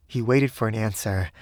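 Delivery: ordinary delivery, nothing marked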